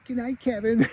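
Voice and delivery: put-on high kid-voice retort; Kevin. Kevin answers mom's goodnight, high mock retort. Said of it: "he's like".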